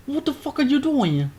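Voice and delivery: high, urgent disbelieving yell; frantic. Acted. Kobe yells at Waffle Sniff stuck on the counter, frantic. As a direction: snap at a stuck mate in panic. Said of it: "frantically says to Waffle Sniff".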